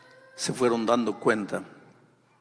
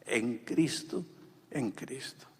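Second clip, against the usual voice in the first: softer each time, slow; fading repetition; 7 dB quieter, slower than usual. Acted. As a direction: let it die away to almost nothing. Preacher repeats key phrase softly. (repetición que se apaga, muy suave)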